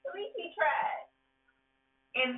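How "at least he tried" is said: forgiving. Retold or imitated. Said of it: "she's accepting of it".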